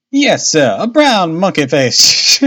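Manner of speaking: lightly voiced dialect woman; grumbling boatman's wife. A narrator's reading. Narrator voices Missus Smith.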